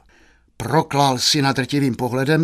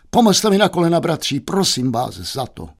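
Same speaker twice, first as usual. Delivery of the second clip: theatrical plea; 6 semitones higher than usual. Acted. Youth playing dying hero before maid, hammed-up plea.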